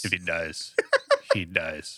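deadpan imitation of a cold threat; movie villain quote. Co-host echoes the movie line.

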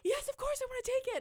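gasp, then very high squealed yes; excited scream. Retold or imitated. Accepting the job offer on the phone, excited squeal. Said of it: "trying to contain my excitement, but I screamed".

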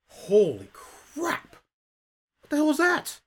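high, slow shocked outburst; shocked human. Acted. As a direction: gasp it out stunned. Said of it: "The human sort of looks up at you and goes".

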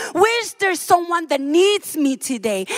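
usual speaking voice

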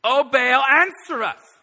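high, loud wailing plea; desperate cry to Baal. Retold or imitated. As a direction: call out desperately to a silent god.

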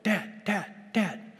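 put-on high, eager little-kid calls of Dad; excited toddler calling. Toddler greeting her dad at the door, high and eager. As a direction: call for Dad like a thrilled toddler. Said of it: "She's like".

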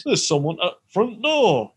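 loud, slow Yorkshire announcement; Yorkshire accent. Acted. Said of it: "in a Yorkshire accent".

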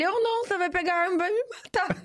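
high mock panic through laughter; recusa apavorada imitada. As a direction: imitate a scared husband, half laughing. Wife mimics frightened husband refusing. (pavor fingido, agudo e risonho)

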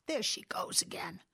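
whispered through a twinge of pain. Acted. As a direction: breathe it as the ache hits. Burglar's arm pain strikes.

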